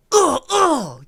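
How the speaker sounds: loud high strained grunts; fight grunts. Acted. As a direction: grunt like you're being stabbed. Struggle sounds in earpiece.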